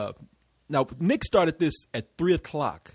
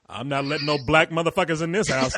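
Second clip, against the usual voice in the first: mock stern father; 2 semitones lower than usual. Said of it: "he makes his dad sound like".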